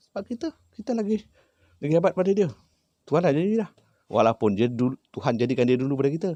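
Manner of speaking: high, loud, fast preaching at the spirit; forceful lecture. Retold or imitated. Healer lecturing the spirit chief on God's order, loud and fervent. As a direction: hammer home that humans are above him.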